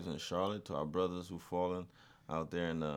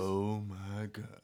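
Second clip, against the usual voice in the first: slow drawn; much slower than usual. Pretend. Echoing catchphrase.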